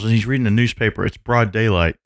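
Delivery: ordinary delivery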